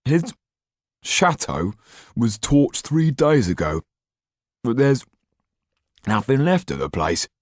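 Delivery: high, halting talk through food; mouth full. A narrator's reading. Didier reports on the Marquis while eating, mouth full. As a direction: talk round a big mouthful of bread. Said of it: "started to speak with his mouth full".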